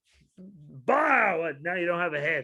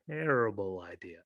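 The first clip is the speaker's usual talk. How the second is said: low, quiet punchline; muttered verdict; deadpan drop; 10 semitones lower, 8 dB quieter than usual. Real, not acted. Drops low after a setup.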